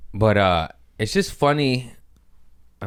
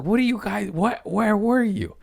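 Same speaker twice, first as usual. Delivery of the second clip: incredulous high yell. Retold or imitated. Berating his passengers for missing the near crash, in a shrill disbelieving yell. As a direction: voice shoots way higher in disbelief. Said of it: "I was like".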